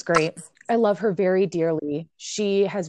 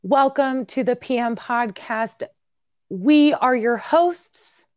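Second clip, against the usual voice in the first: slow, higher and louder announcer voice; mock radio host intro; 5 semitones higher, 3 dB louder, slower than usual. Host greeting podcast listeners, slow higher hammed-up announcer voice. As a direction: ham up the big show opening. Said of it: "This is me now".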